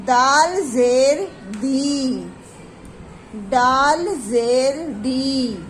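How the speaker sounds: very slow, drawn-out letter chant with falling ends; slow drilled reading. Shown as a demonstration. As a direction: chant each letter slowly and clearly for the class. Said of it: "इस आवाज़ को आप ध्यान से पढ़िएगा". (बहुत धीरे, खींचकर, हर अक्षर साफ़ पढ़ाना)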